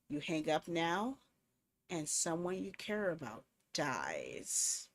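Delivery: low, slow, hoarse threatening voice; deep gruff villain on the phone; gruff menacing caller. Acted. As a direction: growl it like a faceless stalker. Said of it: "a hoarse, gruff voice".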